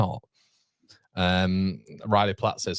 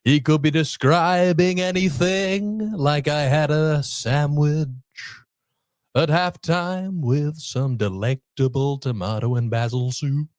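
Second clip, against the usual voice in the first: slow, drawn-out singer impression; 4 semitones higher, slower than usual. Streamer describes halftime food as the singer would, impression. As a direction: talk and sing in long, stretched syllables. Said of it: "rate my [name] impression".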